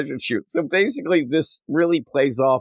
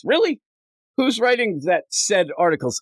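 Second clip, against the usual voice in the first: much higher skeptical challenge; incredulous "Really?"; 7 semitones higher than usual. Retold or imitated. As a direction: doubt him openly. Skeptical passenger.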